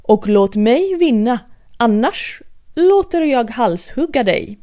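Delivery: loud royal threat; a loud bossy queen warning; threatening command. Acted. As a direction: threaten her with the axe. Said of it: "befallde drottningen".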